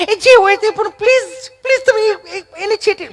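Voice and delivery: high, stammering begging; mock frightened plea. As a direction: plead in comic panic to keep the dog away. Frightened visitor pleads for distance.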